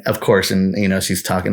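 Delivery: usual speaking voice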